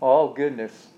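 usual speaking voice

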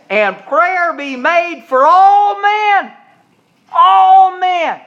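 slow, high, loud scripture call; thundering sermon cry; preaching at full force. Acted. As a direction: stretch each word to the back pew.

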